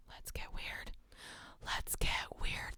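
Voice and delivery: soft, creepy ear whisper. Pretend. Streamer trying the line as an ear whisper. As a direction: whisper it right into their ear. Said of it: "good one to say in someone's ear".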